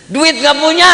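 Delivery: exasperated outburst. Retold or imitated. Inner panic at hosting guests.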